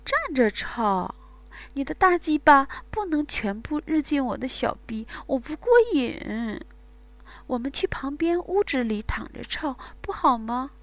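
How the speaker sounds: panting, breathless coaxing; out of breath. Acted. Woman to her lover, breathless and coaxing. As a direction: speak between gasping breaths. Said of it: "上气不接下气地说".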